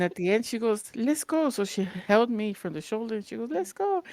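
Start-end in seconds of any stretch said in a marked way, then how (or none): 0.9-1.5 high chirpy call; imitating Lisbeth. Lisbeth cheering at the end. 3.5-4.0 high chirpy call; imitating Lisbeth. Lisbeth repeats, holding shoulder.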